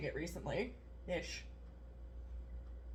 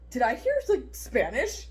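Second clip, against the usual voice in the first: confused yoga client; 4 semitones higher, 3 dB louder, slower than usual. Retold or imitated.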